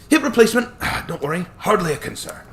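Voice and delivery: put-on chuckling old attorney, light Scottish touch; cheerful old Scottish lawyer. Hammond, sigh.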